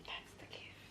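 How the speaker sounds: soft conspiratorial whisper. Real, not acted. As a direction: whisper the secret to viewers. Whispered aside to camera.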